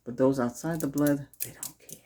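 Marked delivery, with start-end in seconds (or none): 1.4-2.0 unvoiced, hushed whisper. Real, not acted. Whispering a warning to viewers about outsiders. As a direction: drop to a secret whisper.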